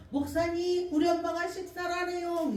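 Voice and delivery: high, urgent voice of a daughter on the phone; a daughter calling out in worry; worried daughter. Retold or imitated. Daughter phoning the pastor about her mother, high and urgent.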